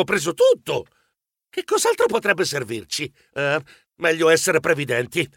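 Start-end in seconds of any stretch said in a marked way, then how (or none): none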